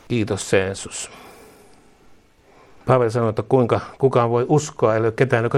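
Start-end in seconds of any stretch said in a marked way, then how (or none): 0.1-1.1 soft, slow, low prayer of thanks; quiet thanks. Real, not acted. Preacher quietly thanking Jesus after amen, soft and slow. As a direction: murmur thanks to Jesus gently.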